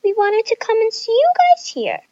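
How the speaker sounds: high, sing-song innocent voice; feigned innocence. A narrator's reading. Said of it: "Mackenzie and Nina said innocently".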